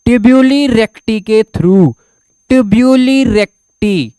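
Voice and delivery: very slow, drawn out; dictating pace; slow spelled-out term. Shown as a demonstration. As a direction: stretch each syllable of tubuli recti for students. Teacher drawing out term.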